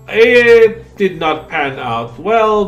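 each word slow and loud; slow emphatic. Real, not acted. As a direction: drag it out for the joke. Dry joke about nukes.

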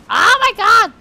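loud, high-pitched shriek of shock; startled shout. Real, not acted. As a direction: cry out in sudden fright. Startled during scary game moment.

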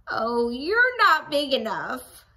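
loud, slow scornful put-down; dismissive. Acted. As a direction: brush them off grouchily. Ladybug dismissive.

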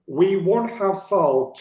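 slow, clear textbook reading; careful reading. Shown as a demonstration. Teacher reading for students, slow and careful. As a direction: give "We won't have solved" full, separate words. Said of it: "önce İngilizcelerini okuyorum".

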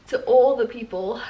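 ordinary talk

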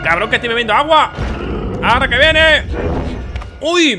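loud, high furious shout; angry yell. Real, not acted. Player yelling at the enemy that caught him drinking, furious shout. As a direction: yell at the game for catching you mid-sip.

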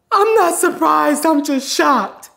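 slow, high tearful mock voice; imitated crying. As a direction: draw it out like a weeping mom. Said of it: "as she started crying".

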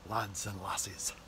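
put-on Scottish accent. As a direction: greet them like a Scottish uncle. Scottish address to viewers.

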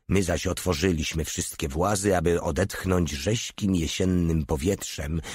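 ordinary delivery, nothing marked

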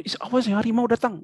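high, startled exclamation; mock alarm. Shown as a demonstration. Teacher acts patient hallucinating a tiger, mock alarm. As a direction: gasp it out as if seeing danger.

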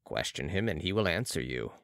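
low, calm character voice; Balsamo assured. A narrator's reading. Balsamo invites Marat to question the patient, low assurance. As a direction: pitch it down with quiet assurance.

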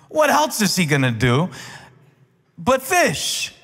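high, loud, fervent preaching climax; preaching at full force. Acted. Pastor rallies the congregation with fervent full-force preaching. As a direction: build the sermon to a fiery peak.

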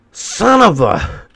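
loud, slow, dragged-out frustrated yell; frustrated shout. Real, not acted. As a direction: let the curse stretch out and blow up, high and loud. House destroyed in the game.

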